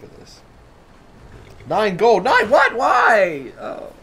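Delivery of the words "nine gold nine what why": loud, high-pitched burst of short questions; outraged disbelief. Real, not acted. Gamer fined nine gold by guard, outraged disbelief. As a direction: explode at a petty fine.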